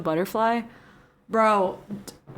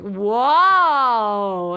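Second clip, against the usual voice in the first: loud, drawn-out mock-amazed wow; 8 dB louder than usual. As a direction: draw it out big, in awe. Retelling own amazed reaction.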